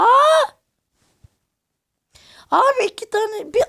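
high, loud startled cry; panicked scream. Real, not acted. Gamer shrieking as two enemies appear, panicked scream. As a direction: scream then blurt in alarm.

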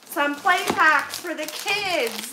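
ordinary talk

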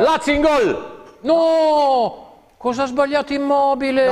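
high, loud excited goal call; breathless italian goal commentary. Real, not acted. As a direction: shout the goal live. Live goal call from another match.

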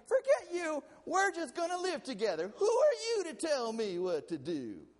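high, loud mocking defiance; a mocking rebellious retort; defiant couple mock. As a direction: throw it back like a defiant young couple. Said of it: "In their accent like that".

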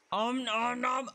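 high cartoon nom-noms; playful munching noises. Player munching as the animal, playful nom-noms. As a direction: pretend to gobble it up.

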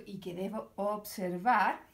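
ordinary talk, nothing marked

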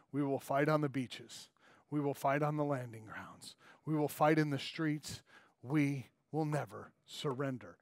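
low, soft hint of Churchill's defiant growl; a lightly voiced Churchill, quiet and resolute; Churchill imitation. Preacher quoting Churchill to the congregation, low and grave. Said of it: "Winston Churchill, who was like".

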